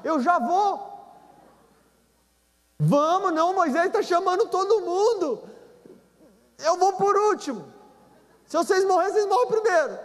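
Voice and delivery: high, nervous and hurried; a nervous coward talking others forward. Retold or imitated. Coward voiced, pushing others. (covarde nervoso)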